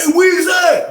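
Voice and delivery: high retold call across the block. Cellmate calling him out of the cell, high call. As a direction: call out high to someone downstairs.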